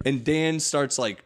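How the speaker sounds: usual speaking voice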